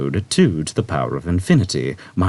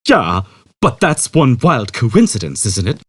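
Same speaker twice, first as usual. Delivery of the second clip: Zaphod; 5 semitones higher, 5 dB louder than usual. A narrator's reading. Zaphod marvelling.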